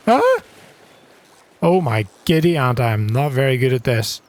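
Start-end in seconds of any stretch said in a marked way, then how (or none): 0.1-0.4 short very high, loud yelp; real high-pitched squeak; startled squeal. Real, not acted. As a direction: yelp in sudden alarm. Struggling to hook the fish.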